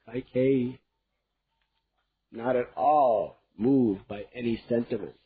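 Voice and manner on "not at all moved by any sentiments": usual speaking voice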